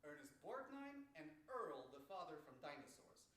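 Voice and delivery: ordinary delivery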